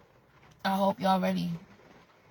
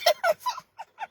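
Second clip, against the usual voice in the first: short high giggle. Real, not acted. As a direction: giggle briefly.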